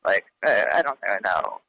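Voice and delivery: imitating a very low, creaky fry; vocal fry. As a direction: drop right down into a mumbly fry. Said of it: "like a fry in his voice".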